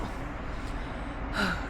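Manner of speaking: long, soft, exhausted sigh; worn-out sigh before a nap; tired sigh. Real, not acted. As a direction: sink into the pillow with a sigh. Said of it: "I'm exhausted".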